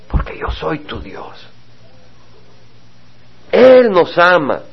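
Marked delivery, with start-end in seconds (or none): 0.1-1.5 whisper. Acted. Preacher drops to whisper after proclaiming.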